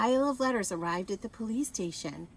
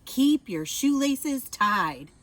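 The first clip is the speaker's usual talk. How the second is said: slow, loud policeman's safety tip; slow booming safety rule; Officer Buckle lecturing; 5 semitones higher, 8 dB louder, much slower than usual. A narrator's reading. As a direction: announce it slowly like a proud officer.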